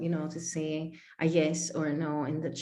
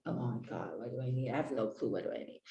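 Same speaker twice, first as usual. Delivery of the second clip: softer, lower flustered self-talk; panicked muttering; 7 semitones lower, 10 dB quieter, faster than usual. Retold or imitated. Client panicking at the coach's question, muttering softly. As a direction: mutter in a quiet panic, trailing to a whisper.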